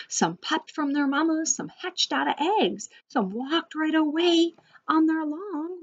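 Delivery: lively, lilting picture-book narration; reading to children. A narrator's reading. Teacher opening a rhyming zoo story for children, lively storytelling. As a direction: read the rhyme aloud with a swinging tune.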